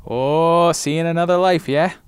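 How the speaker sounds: playful imitation of a Scottish accent; Scottish accent echo. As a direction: parrot the Scottish accent back. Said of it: "can we talk about my accent right there".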